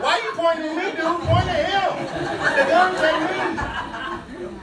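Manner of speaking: high, loud, laughing complaint. Retold or imitated. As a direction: laugh through the retold protest. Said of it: "I'm like".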